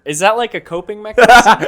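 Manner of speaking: laughing. Real, not acted. Laughing while asking.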